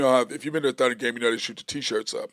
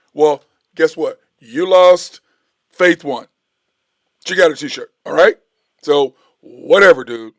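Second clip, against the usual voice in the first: gloating taunt; 2 semitones higher, 11 dB louder than usual. Real, not acted. Gloating at the older man.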